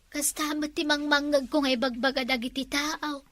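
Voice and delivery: ordinary talk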